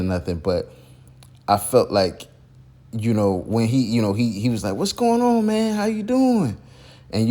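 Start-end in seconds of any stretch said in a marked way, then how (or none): 4.7-6.6 high, hyped-up phone hello, slowing on doing; excited greeting. Retold or imitated. Mentor greeting Percy on the phone, high excited hello. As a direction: imitate a big enthusiastic welcome.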